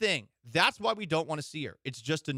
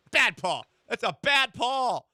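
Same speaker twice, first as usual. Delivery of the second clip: louder, slow, higher scolding like to a bad dog; mock dog scolding shout; 8 semitones higher, 5 dB louder, slower than usual. Host hammily scolding co-host Paul like a bad dog. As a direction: bark it at him like a naughty puppy.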